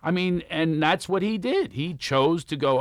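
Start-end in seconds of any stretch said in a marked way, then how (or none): none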